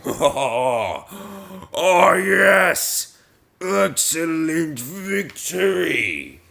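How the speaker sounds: slow, drawn-out ironic praise; hammed-up sarcastic cheer; mock congratulation. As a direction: gloat grandly, stretching the words. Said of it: "if I was to say".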